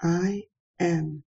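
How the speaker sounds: ordinary delivery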